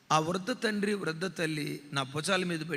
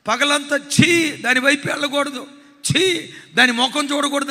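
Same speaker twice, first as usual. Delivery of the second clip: disgusted vow; 6 semitones higher, 10 dB louder than usual. Retold or imitated. Son telling his father how he swore off liquor, loud and disgusted. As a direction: reject the drink with loud disgust. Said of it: "ఏడుస్తూ అంటాడట".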